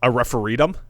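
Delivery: "referendum" said as "referee-dum"; punning pronunciation. Pretend. Co-host puns on the referee vote, "referendum" said as "referee-dum".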